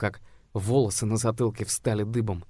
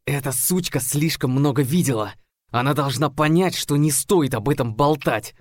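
louder, tense menacing hiss; hissed threat; 4 semitones higher, 6 dB louder than usual. A narrator's reading. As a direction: spit out the threat at the cheerleader. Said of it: "прошипел он".

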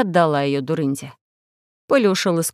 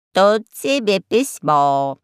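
raspy cawing crow; much slower than usual. Acted.

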